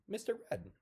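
high-pitched quote of the name-giver; put-on high voice for a quote; imitated name-giver. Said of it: "he would say".

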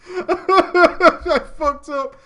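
loud, high, drawn-out laughing lament; laughing wail. Real, not acted. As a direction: wail it through helpless laughter. Laughing wail over his mistake.

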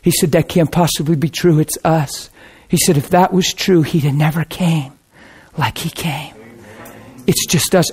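soft, hushed tender reassurance; tender reply. Retold or imitated. Husband softly reassuring his wife, tender and quiet. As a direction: gently comfort her through tears. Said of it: "his eyes filled with tears".

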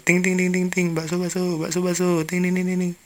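high, chanting bell and hawker call; imitated meatball vendor. Vendor happily pushing his cart, mimicked chanting call. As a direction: tinkle and hawk like a street seller. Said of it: "Abangnya kan lagi enak-enak dorong".

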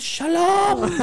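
high, loud hail breaking into laughter; calling out. Retold or imitated. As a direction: holler a greeting to a passer-by. Acting calling out of car window.

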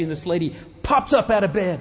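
ordinary talk, nothing marked

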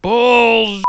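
imitating Keith. Fan imitating Keith's angry comeback, loud and high. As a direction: bark it out like an angry wrestler. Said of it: "And then he did".

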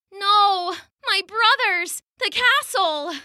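high, pained cry of loss; despairing cry. Acted. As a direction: cry out as the pearls sink. Watching pearls sink away.

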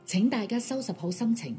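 normal talking voice

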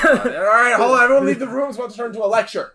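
loud, high joking warning; loud teasing. Real, not acted. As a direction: shout a playful alarm.

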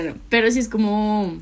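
ordinary talk

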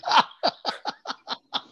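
loud, high, breathy laugh; the comic cracking up as he retells the prank; high burst of laughter. Real, not acted. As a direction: crack up at the joke. Prank revealed, big laugh.

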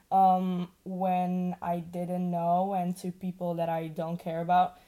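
ordinary delivery

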